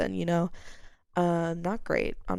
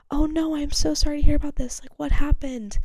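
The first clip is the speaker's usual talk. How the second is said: high, loud sugary sympathy; fake concern; 8 semitones higher, 8 dB louder than usual. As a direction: imitate the drunk boss's over-sweet concern. Drunk manager's reply, imitated.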